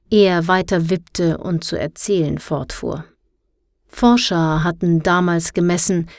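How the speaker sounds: ordinary delivery, nothing marked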